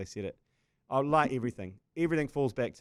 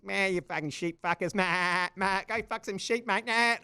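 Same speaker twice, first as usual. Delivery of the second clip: a hint of a jeering Aussie fan; mock the taunting crowd; lightly voiced jeering fan; Aussie heckler; 7 semitones higher than usual. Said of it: "in Aussie, it's relentless".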